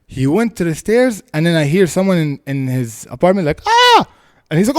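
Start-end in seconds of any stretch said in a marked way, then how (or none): 3.7-4.0 very high, short mock scream; sudden high retold shriek; imitated scream. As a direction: mimic a wife screaming from inside. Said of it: "the lady screamed his wife".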